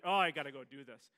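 loud burst of sudden resolve. Retold or imitated. Giving in and deciding to help the lady, loud burst. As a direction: snap into action all at once.

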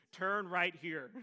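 slow, measured imitation of a sat-nav lady; GPS lady voice. Pastor mimicking the GPS on his dash, lightly voiced sat-nav lady. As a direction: lightly imitate a GPS voice, drawing out each word. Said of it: "this beautiful young lady's voice tell me".

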